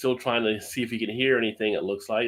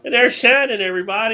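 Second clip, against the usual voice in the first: excited announcement; 11 semitones higher, 7 dB louder than usual. Real, not acted. Host spots engineer on camera.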